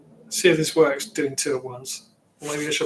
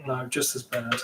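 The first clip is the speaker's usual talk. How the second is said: usual speaking voice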